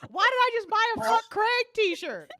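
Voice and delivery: high-pitched mock confusion; baffled customers. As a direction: play a buyer regretting a puzzling purchase. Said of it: "People are gonna be like".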